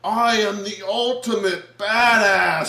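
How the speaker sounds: slow, loud, hammed-up villain boast. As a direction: proclaim it like a supercharged movie villain. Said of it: "and he's like".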